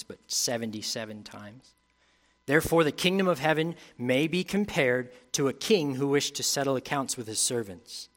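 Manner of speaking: measured Bible reading to the congregation; scripture reading. Acted. Preacher reading scripture to the congregation, measured reading. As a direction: read the parable out to the church. Reads the Matthew passage aloud.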